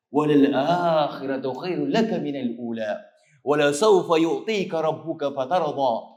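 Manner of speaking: slow, melodic Quranic chanting; Quran recitation. Acted. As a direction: recite it slowly, like tajweed. Recites Surah Duha in sermon. (mabagal at malambing na pagbigkas ng Quran)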